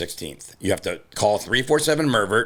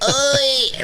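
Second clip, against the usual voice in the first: sharp outcry; 4 dB louder than usual. Real, not acted. Protests being called racist.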